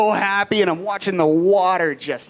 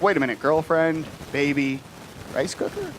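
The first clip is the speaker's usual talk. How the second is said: puzzled questioning; 3 semitones lower, 4 dB quieter than usual. Retold or imitated. Imitated puzzled audience questioning him.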